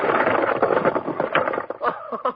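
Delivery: short laugh under the breath; amused breathy laugh. Acted. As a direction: chuckle at the half man. Hero laughs at ghost.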